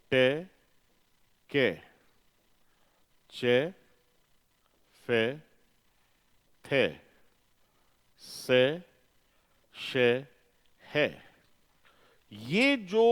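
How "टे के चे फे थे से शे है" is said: "टे", "के", "चे", "फे", "थे", "से", "शे", "है" said one by one as short, separate sounds; voiceless consonant sounds. Shown as a demonstration. Teacher sounding out each voiceless consonant for the class. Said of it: "ये साउंड पे".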